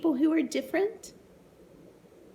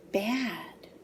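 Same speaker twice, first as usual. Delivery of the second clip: breathy hushed final word; dramatic whisper; 4 dB quieter than usual. A narrator's reading. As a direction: drop to a whisper on the end.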